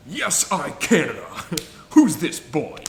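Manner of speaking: a playful Keanu Reeves imitation, laughing halfway; Keanu Reeves as Duke Kaboom. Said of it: "every time he's like".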